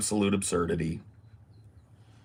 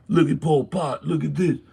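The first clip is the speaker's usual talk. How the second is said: mocking naysayer; 3 semitones higher than usual. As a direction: mimic the people who dismiss communism. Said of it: "They go".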